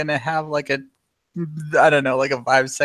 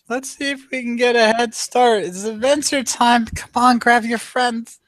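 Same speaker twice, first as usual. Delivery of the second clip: cheerful call; 9 semitones higher than usual. Acted.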